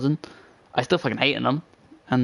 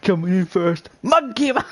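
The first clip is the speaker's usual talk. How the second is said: high, hammed-up ring announcer; big mock introduction of a fighter; 7 semitones higher, slower than usual. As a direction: imitate a fight announcer, climbing high on the name. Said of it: "fucking fucking Ring announcer".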